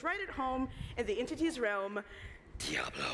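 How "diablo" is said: deep growling demon voice. Acted. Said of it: "glad I got to do my demon voice".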